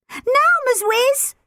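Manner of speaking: high, loud desperate scream. A narrator's reading. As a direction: scream for her to act now. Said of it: "screamed Tilly".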